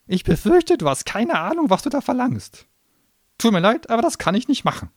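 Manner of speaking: high, loud sneering gnome voice; a cornered little demon spitting scorn; shrill gnome tirade. Acted. Gnome refusing.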